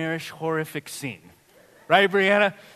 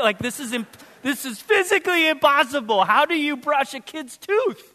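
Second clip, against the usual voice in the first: exasperated parents; 6 semitones higher than usual. Retold or imitated. Parents pleading with the dentist.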